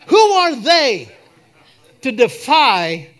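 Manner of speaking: loud, high-pitched rallying cry; full-force preaching. Real, not acted. Preacher urging the church to defy its enemies, fiery preaching. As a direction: preach it loud, punching each phrase hard.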